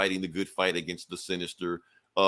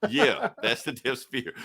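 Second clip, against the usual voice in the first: "Death Sphere" said through a laugh; amused at the name; chuckling repeat; 4 semitones higher than usual. Real, not acted.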